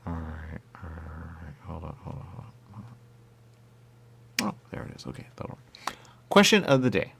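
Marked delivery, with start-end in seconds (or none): 0.0-6.0 very soft talk to oneself; quiet aside. Real, not acted. Breaking off to sort something out, quiet aside. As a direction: keep it very soft, half to yourself.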